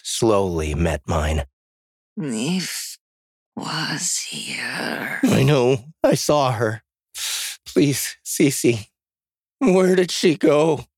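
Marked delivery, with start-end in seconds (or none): 2.2-5.2 high, soft, drawn-out voice; weak, slurred moaning. A narrator's reading. The dying woman answers weakly. 5.2-10.9 high, urgent begging; panicked pleading. A narrator's reading. Panicked pleading with dying woman.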